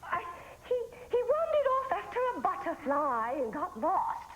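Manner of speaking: high-pitched fake Swedish accent; put-on Swedish accent. Fake camp worker explaining where the boy went, put-on Swedish accent. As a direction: improvise an excuse in a high mock Swedish accent. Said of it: "You sound funny with that accent".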